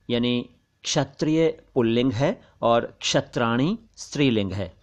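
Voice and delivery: ordinary delivery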